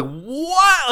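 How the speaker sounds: high, bewildered newborn voice. Acted. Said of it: "He just goes".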